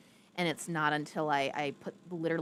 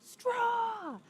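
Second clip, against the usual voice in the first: mock crying; 3 dB louder than usual. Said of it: "and you're like".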